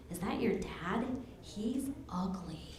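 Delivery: low near-whisper, imitating a schoolgirl; hushed. Daughter quoting Ashley about her dad, hushed whisper. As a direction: drop to a whisper as if passing on an insult.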